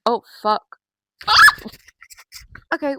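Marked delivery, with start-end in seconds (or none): 0.1-0.8 startled curse. Real, not acted. Sees stink bug, panics.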